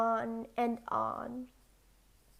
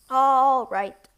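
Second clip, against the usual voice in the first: tailor's reply; 11 dB louder than usual. A narrator's reading. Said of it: "replied the tailor".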